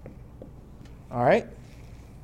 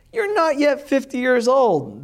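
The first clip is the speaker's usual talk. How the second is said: much higher, mocking disbelief; the crowd sneering at Jesus, voice pitched up; scoffing crowd; 9 semitones higher, 4 dB louder than usual. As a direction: scoff at the claim in a high mocking voice.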